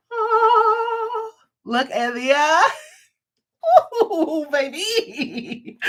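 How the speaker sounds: high, drawn-out oohs and cooing; gushing delight. Real, not acted. Host revealing her new hooks to viewers, gushing. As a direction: swoon over it, stretching the sounds high.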